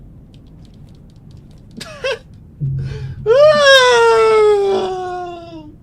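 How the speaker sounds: loud, high shrieking cry-laugh; scream-laugh at your own death. Real, not acted. Just died, big shriek laugh.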